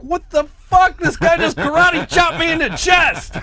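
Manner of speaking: high, loud fake indignant shout; hollering in pretend shock; mock outrage. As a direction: Rampage after the chest chop, high mock outrage. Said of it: "Rampage is like".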